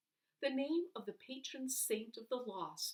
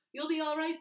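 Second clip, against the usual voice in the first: reassuring doctor; 8 semitones higher, 8 dB louder, faster than usual. Acted.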